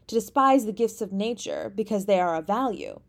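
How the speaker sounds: ordinary delivery, nothing marked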